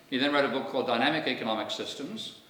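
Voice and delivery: ordinary delivery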